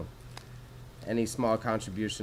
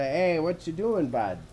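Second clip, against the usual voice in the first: lightly put-on Alberta accent, drawn out; slow Canadian "hey, bud" accent, starting higher; 5 semitones higher, 3 dB louder, slower than usual. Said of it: "they sound like your stereotype accent".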